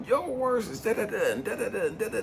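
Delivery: high-pitched mock recitation; words as weapon. Man reading scripture at God to make him act, insistent. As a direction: rattle it off high, imitating insistent quoting. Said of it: "using his words as a weapon".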